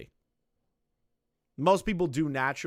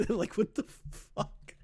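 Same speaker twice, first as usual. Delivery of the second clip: laughing; 8 semitones higher, 5 dB quieter, slower than usual. Real, not acted. Laughing at imagined call.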